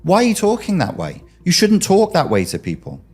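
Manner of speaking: high, defiant imagined comeback; indignant pushback. Retold or imitated. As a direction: push back hard, offended and indignant. Imagined victim pushing back indignantly.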